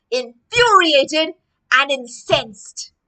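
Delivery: slow and loud, each word weighed; emphatic angry words. Shown as a demonstration. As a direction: act out the anger of the words. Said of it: "So other words for angry".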